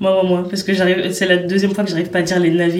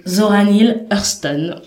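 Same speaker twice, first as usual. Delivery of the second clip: ordinary talk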